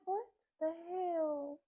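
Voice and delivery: high, slow disbelieving what the hell; stretched-out shocked disbelief; mock shock at a woman on the line.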